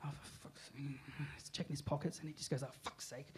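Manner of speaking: mutter. Retold or imitated. Darren muttering as he checks his pockets. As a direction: grumble under the breath.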